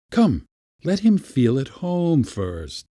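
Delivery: high, measured order, slowing after the first word; commanding. A narrator's reading. Uncle to Praskovya over the kitten, commanding. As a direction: take charge, drawing it out. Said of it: "Pyotr Demyanitch commanded".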